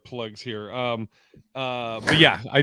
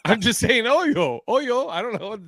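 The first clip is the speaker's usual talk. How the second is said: Oyo said much higher with a laughing lilt; laughing and pitched up on Oyo, unsure what accent it is; accidental accent; 8 semitones higher than usual. Retold or imitated. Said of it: "Was that an accent?".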